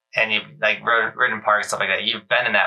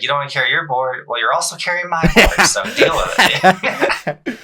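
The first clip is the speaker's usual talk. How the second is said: rising, louder joking taunt ending in a laugh; teasing dad; 7 semitones higher, 4 dB louder than usual. Retold or imitated. Dad teasing Roman about carrying both boards, in a rising, louder taunt that breaks into a laugh. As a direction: rib the kid and crack up. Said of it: "Next time I'm like".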